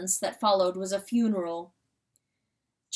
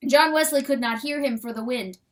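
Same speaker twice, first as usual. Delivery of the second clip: usual speaking voice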